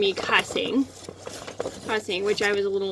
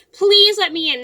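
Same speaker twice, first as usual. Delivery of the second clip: pleading yell; 8 semitones higher, 7 dB louder than usual. Retold or imitated. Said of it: "I'm like".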